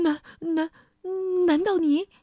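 stammering scared king; frightened stutter. A narrator's reading. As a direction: trip over the words in fright. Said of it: "国王吓得呀，连说话都结结巴巴的了". (吓得结结巴巴)

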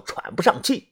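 plain reading voice

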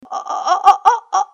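high, loud staccato shriek; rapid stuttering screams of terror; startled cry. A narrator's reading. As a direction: scream in fast little bursts before fainting. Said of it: "发出了这样的声音".

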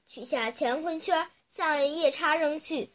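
usual speaking voice

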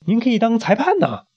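high coaxing voice; character coaxing the queen; coaxing suggestion. Acted. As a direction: coax the queen sweetly.

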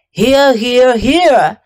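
honking gander voice saying each "here"; a gander who can't stop repeating "here". Acted. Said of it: "You sound like three ganders".

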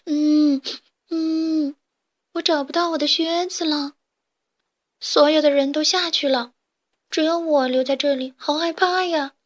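high, tearful whimpering complaint; crying chick. A narrator's reading. Lost green chick tells strangers about its boots, tearful and high. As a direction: sob out that you are scared and lost. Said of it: "正在地上哭".

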